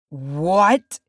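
loud, rising disbelief; stunned exclamation, voice swinging up; shocked. A narrator's reading. Dwarf leader hearing of betrayal, shocked.

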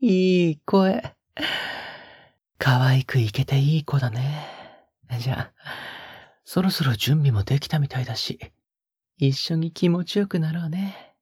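breathy close-mic whispers with a teasing edge; a lover's hushed, teasing murmur; intimate ASMR whisper. Acted. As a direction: whisper right into the listener's ear, coaxing. Intimate whispered roleplay throughout.

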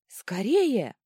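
loud, high urgent shout; urgent roar. A narrator's reading. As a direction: yell to hurry someone over. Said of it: "взревела Клозильда". (нетерпеливый рёв)

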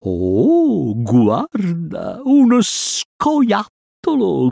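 slow serpent voice swooping high; drawn-out sibilant snake voice; hissing snake. A narrator's reading. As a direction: hiss and stretch the words. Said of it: "sibilò Kaa".

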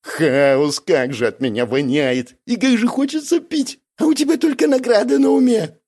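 near tears. A narrator's reading. Бяка stinking, thirsty and fed up, near tears. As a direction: whine climbing to a high wail. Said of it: "Чуть не заплакал Бяка".

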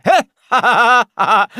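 high, loud jeering cackle; loud taunting cackle; mocking laugh. A narrator's reading. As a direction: laugh in their faces. Jingle laughs at them.

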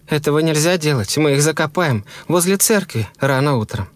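high woman's voice, insisting; insistent wife. A narrator's reading.

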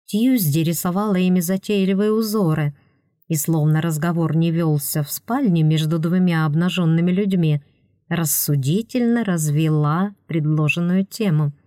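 ordinary delivery, nothing marked

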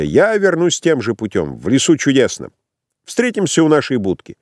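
cheerful man. A narrator's reading. Dreyer cheerfully declines the boat. (бодрый, беззаботный голос повыше)

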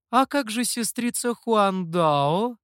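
slow, high, drawn-out teasing question; drawling. A narrator's reading. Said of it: "протянула лисица". (протяжно, высоким голосом)

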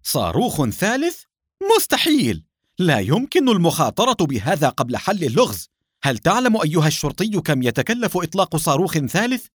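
high-pitched, incredulous outburst; outraged shout. A narrator's reading. Doctor confronting Nour over a third rocket, crying out in outrage. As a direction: cry out in disbelief, pitch leaping up. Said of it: "صرخ الدكتور سامي في وجه نور".

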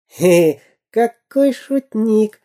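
high, slow mocking chuckle; mildly amused pirate; laughing. A narrator's reading. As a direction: laugh off the joke. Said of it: "засмеялся Дырка".

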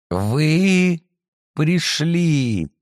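cracked, sickly voice. Acted. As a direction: speak through a cracked sick throat. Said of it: "Голос у неё был надтреснутый, словно больной".

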